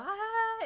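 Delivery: high, loud mock roller coaster scream. Retelling the loop, she screams high and loud. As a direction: let out a sharp high scream. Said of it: "So I'm screaming".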